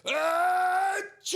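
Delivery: big booming achoo; loud sneeze. Acted. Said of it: "makes the bear".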